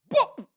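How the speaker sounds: high, loud "boop!"; collision sound effect. Pretend. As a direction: pop out the bump noise. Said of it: "bumping into her".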